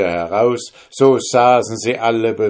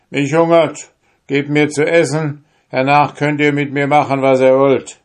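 bold soldier demanding; 7 semitones higher, 7 dB louder than usual. Acted. Soldier demands food boldly.

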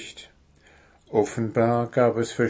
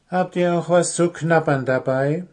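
higher eager question; kobold asking; 7 semitones higher than usual. Acted. Kobold asks girls for something to nibble. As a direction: ask hopefully for snacks. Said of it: "erkundigte sich Wuschel".

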